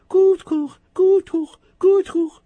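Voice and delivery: very high loud clucks; loud high hen cluck. Acted. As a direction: cackle like a hen.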